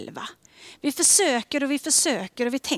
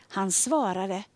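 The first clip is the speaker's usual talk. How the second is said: usual speaking voice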